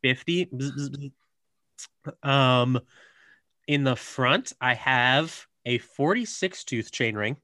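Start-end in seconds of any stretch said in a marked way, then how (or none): 0.5-2.3 buzzing robot sound effect; robot noises. Pretend. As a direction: make mechanical buzzes like a robot. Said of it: "Robot noises".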